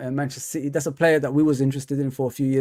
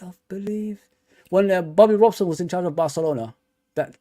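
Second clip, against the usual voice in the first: normal talking voice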